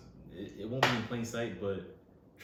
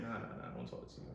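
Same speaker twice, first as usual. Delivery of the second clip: put-on fast, quiet New York accent; 9 dB quieter, much faster than usual. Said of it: "we gotta put the New York accent on".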